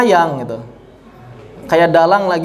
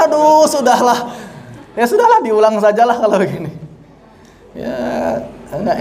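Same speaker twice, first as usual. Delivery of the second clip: laughing through the words; amused groan, still laughing; 3 semitones higher than usual. Real, not acted. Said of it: "laughing at the imitation". (tertawa geli sambil mengeluh)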